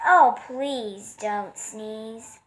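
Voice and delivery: slow, drawn-out refrain; slow begging plea; pleading. Acted. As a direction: linger on each word. Giraffe's refrain.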